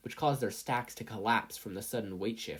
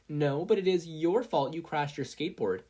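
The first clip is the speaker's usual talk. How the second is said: mild quarrel voice; 6 semitones higher, 5 dB louder than usual. A narrator's reading. Mina arguing with Mei.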